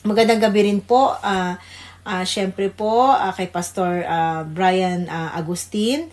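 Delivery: ordinary talk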